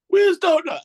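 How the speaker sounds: high, loud comic catchphrase; mimicking a sitcom line; TV catchphrase impression. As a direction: shout it up high like the TV show. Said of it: "all you used to say is".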